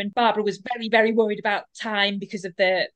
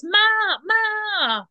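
high-pitched calling out for mother; mock shout; 10 semitones higher than usual. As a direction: imitate a cry for help without full volume. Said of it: "so she shouts".

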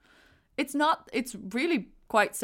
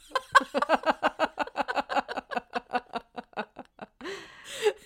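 long rising giggle; high laugh; 9 semitones higher, 4 dB louder than usual. Real, not acted. Laughing at the answer.

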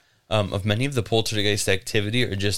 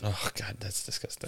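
whispered disgust; 13 dB quieter than usual. Real, not acted. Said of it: "reacting to filth".

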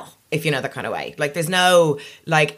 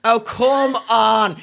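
louder, drawn-out objection, pitched up; really indignant, stretching each word; exasperated protest; 6 semitones higher, 4 dB louder, much slower than usual. Real, not acted. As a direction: push back slowly and emphatically. Protesting an accusation of appropriation.

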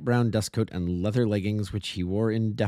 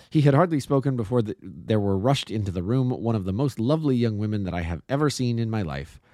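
ordinary delivery

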